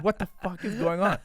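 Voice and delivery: high, loud alarmed question; panicked outburst. Retold or imitated. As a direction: blurt it out in a rising panic. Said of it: "and I'm like".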